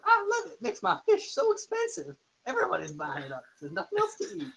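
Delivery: squeaky high-pitched fisherman. Acted. Fisherman NPC voiced.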